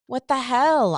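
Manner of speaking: high, loud "What the hell?"; indignant outburst. Retold or imitated. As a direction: flare up at being treated like a kid. Retold being finger-pointed at.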